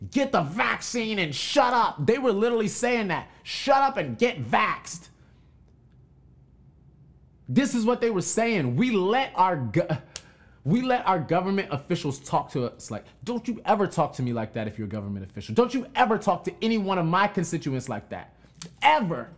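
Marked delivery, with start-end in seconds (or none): 0.1-2.1 bossy official. Retold or imitated. Mocking officials' bossy command. 3.4-5.1 bossy official. Retold or imitated. Mocking officials' bossy command. 13.2-19.3 shaking with anger. Acted. Scolding government officials.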